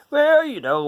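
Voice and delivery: a high, bright put-on voice; playful character voice. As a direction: answer as the deck itself. Voicing the deck character.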